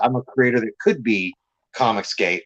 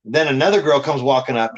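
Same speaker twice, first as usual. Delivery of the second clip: usual speaking voice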